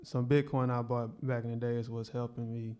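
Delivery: ordinary talk, nothing marked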